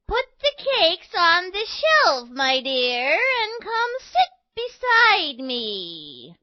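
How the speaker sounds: high, slow falsetto granny; wolf playing granny. A narrator's reading. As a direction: invite in a put-on granny voice. Wolf poses as grandmother.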